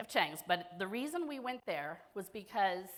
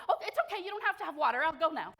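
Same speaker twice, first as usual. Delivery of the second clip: high-pitched hasty backing-off, imitated; put-on higher voice, awkwardly placating; flustered waiter; 8 semitones higher, 4 dB louder, much faster than usual. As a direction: play the waiter retreating from a crying customer. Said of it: "and they'd be like".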